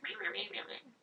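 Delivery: mock her whining in a high bleat; high mocking whine; mocking meh. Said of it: "And she's like".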